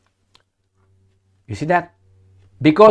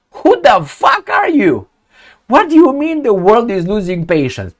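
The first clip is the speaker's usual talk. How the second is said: indignant outburst; 7 semitones higher than usual. Real, not acted. Commentator retorting to a politician's quote, indignant. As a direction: fire it back in high-pitched disbelief.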